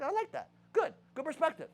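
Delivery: delighted, pitched well up; a bright thrilled reply to a hater; pleased and high. Retold or imitated. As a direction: gush with approval, voice up high. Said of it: "I was like".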